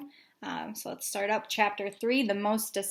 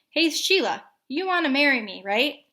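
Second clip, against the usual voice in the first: calling out; 5 semitones higher, 4 dB louder than usual. Acted. Child calls across porch.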